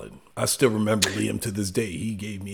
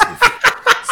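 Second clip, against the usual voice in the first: loud high laugh; 14 dB louder than usual. Real, not acted. Cracking up at his own crude story, loud high laugh.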